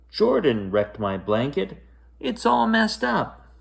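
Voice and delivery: put-on upset girl telling her mother; whiny complaint. A narrator's reading. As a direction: tattle on the brother, near tears.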